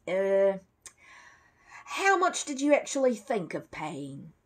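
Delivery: lightly voiced dealer, coaxing; prodding shopkeeper. Shopkeeper haggles with Paddington.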